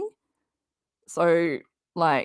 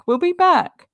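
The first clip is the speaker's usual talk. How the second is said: higher and louder, a mock announcer-style sign-off; a jokey higher echo of the sign-off; playful put-on voice; 8 semitones higher, 5 dB louder than usual. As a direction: say it again up high in a put-on voice.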